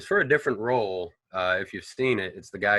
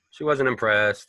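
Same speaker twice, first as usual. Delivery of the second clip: usual speaking voice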